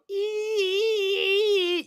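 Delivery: high sung creaking door squeak. Shown as a demonstration. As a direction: imitate a squeaky door hinge. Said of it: "normally where it would go".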